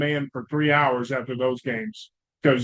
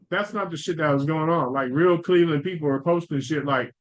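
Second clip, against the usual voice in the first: ordinary talk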